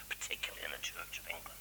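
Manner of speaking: whispered aside, soft and dry; wry whisper. Real, not acted. Preacher whispers a wry aside about the Church of England. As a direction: let the congregation in on a secret.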